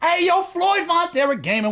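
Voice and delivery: high-pitched hailing call; calling out greeting. Real, not acted. Streamer hails a viewer by name, high calling voice.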